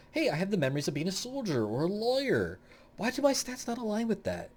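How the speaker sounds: high-pitched, mock-baffled complaint; puzzled player character. Player wondering why the stats don't match, mock puzzled.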